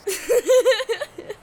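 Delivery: short amused chuckle; a little laugh at the joke. Acted. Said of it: "Victor chuckled".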